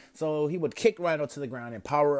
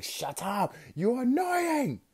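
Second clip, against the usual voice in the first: comic high voice; 13 semitones higher, slower than usual. Acted. Rhino throwing cars at Deku, comically high slow yell. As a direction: squeak it out absurdly high and drawn out. Villain repeats his line, higher.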